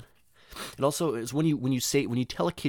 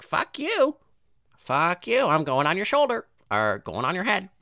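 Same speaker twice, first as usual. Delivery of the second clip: defiant parrot; 8 semitones higher than usual. Acted. Parrot refusing the kid's shoulder, defiant squawk. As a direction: squawk back like a stubborn parrot. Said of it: "climb up your head like".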